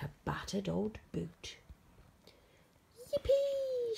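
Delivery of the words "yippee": very high, drawn squeal of joy; excited shout. A narrator's reading. George cheers at tracking the monster, high excited shout. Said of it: "shouted George".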